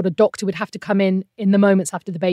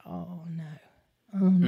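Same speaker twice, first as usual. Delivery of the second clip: dread; 2 semitones lower, 9 dB quieter than usual. Retold or imitated. Scan staff fetching someone else.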